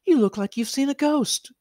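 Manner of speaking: high, sweet cartoon girl voice; gentle teasing from a magical pony girl; bright high pony girl reassuring him. A narrator's reading.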